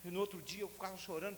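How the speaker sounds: normal talking voice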